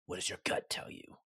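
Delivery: low, hushed, breathy dwarf king; king impression. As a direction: drop it low and almost whisper, as the king. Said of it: "that was a great impression".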